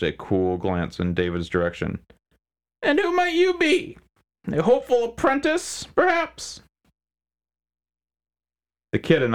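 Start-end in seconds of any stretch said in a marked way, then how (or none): 2.8-6.6 smug crime boss. Acted. McHines voiced character.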